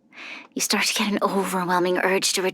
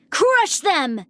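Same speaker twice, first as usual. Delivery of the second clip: commanding shout; 9 semitones higher than usual. Acted. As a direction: bark the attack order. Combat attack shout.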